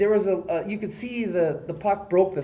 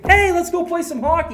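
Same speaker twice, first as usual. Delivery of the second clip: mock cheery; 12 semitones higher, 5 dB louder than usual. Mock cheerful before bad luck.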